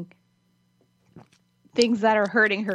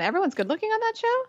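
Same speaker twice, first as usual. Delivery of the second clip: incredulous; 13 semitones higher, faster than usual. Real, not acted. Incredulous question.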